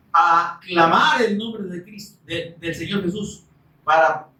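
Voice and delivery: normal talking voice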